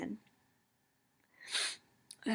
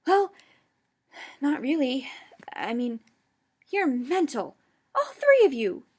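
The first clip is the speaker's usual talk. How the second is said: higher, louder disbelieving growl rising to a shout; incredulous growl; 6 semitones higher, 7 dB louder than usual. A narrator's reading. Ron growling at the three, louder and disbelieving. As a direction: scoff hard, then blow up. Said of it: "Ron growled incredulously".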